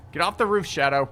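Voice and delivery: loud high yell. Real, not acted. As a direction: shout at the enemy. Yelling at enemy on roof.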